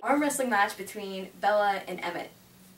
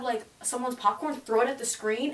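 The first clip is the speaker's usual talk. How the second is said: ordinary talk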